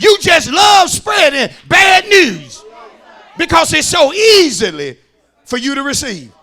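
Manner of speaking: loud, emphatic sermon delivery; full-force preaching. Acted. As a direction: drive each phrase home to the congregation.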